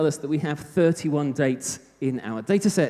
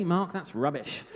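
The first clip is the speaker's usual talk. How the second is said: put-on softer, posh-leaning British accent; 6 dB quieter than usual. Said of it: "You'd say it in a very British accent".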